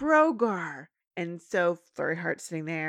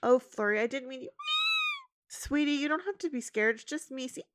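soothing Twilight; 8 semitones higher than usual. Retold or imitated. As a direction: soothe a scared baby.

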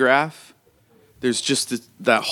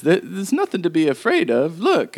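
higher, gentle soothing voice; calm the frightened girl down; the old woman telling Shauna the camera is harmless; reassuring old woman; 10 semitones higher than usual. Acted.

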